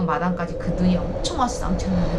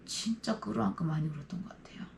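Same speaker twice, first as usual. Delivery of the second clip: softer, whispered recollection; near whisper; 10 dB quieter than usual. Real, not acted. Sister recalling hugging her brother, near whisper. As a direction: barely voice it. Emotional reunion memory.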